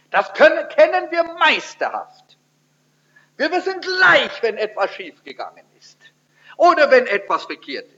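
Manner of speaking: high, loud mock pride; sarcastic boast about knowing God; sarcastic praise. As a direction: oversell it with heavy irony. Sarcastic praise of self-knowledge.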